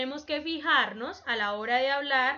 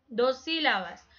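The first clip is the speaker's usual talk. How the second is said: usual speaking voice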